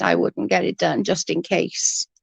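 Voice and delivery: ordinary delivery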